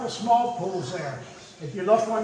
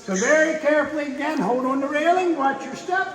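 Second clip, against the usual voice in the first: raised, higher, hurried caution; calling out warning; 5 semitones higher, 4 dB louder than usual. Real, not acted. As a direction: call out to the group to be careful. Tour guide warns group on slippery path.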